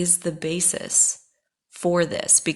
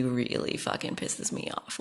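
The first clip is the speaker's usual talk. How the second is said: whispered, much softer, with real anger; seething whisper; 4 semitones lower, 9 dB quieter than usual. Real, not acted. Venting to listeners about her harasser, in a seething whisper. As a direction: hiss the anger under her breath.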